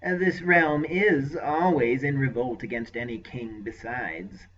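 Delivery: slow, sly aside. Acted. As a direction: slip it in slyly, unhurried. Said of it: "he added in a sly voice".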